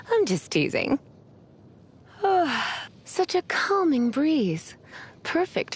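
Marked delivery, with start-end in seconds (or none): 2.2-2.8 high, falling sigh; relaxed sigh. Acted. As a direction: sigh with ease, sliding down in pitch. Enjoying a calming breeze.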